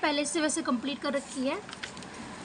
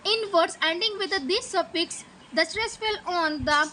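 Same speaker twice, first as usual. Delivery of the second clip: slow reading aloud; 3 semitones higher, much slower than usual. Shown as a demonstration. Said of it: "कि in".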